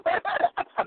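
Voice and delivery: high squeaky giggle; high laugh. Real, not acted. Laughing at own story.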